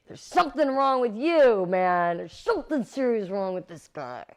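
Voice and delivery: loud, high, freaked-out protest; alarmed exclaiming. Retold or imitated. Actress reacting to a method actor, loud and alarmed. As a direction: blurt it out as if he's crazy.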